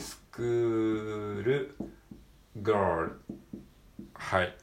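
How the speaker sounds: normal talking voice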